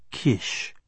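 "Kish", a slurred "kiss" ending in sh; slurred pronunciation. A narrator's reading. Narrator quoting the drunk woman, slurred "Kish". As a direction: end "Kish" on a soft sh. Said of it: "She might have said".

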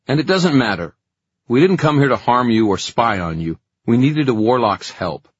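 lightly voiced sharp elder; a hint of a stern warlock. Hypatia answers Barnabas.

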